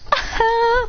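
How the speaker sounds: high-pitched "aha!"; acted high exclamation. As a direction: let out a high, short aha. Puts scythe on back, exclaims.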